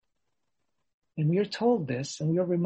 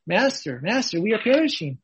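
loud, higher desperate cry; cry of despair; 5 semitones higher, 6 dB louder than usual. Retold or imitated. Frightened disciples cry to their master, loud despair. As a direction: shout it as if all is lost. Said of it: "the cry of despair of little faith".

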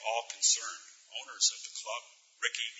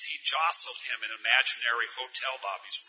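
ordinary delivery

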